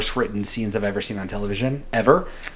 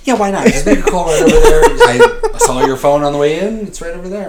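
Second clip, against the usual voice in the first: mock cop; 5 semitones higher, 4 dB louder than usual. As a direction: sweetly point him to the phone, laughing. Mock cop pushing a call.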